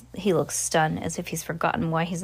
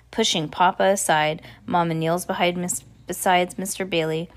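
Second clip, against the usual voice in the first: ordinary delivery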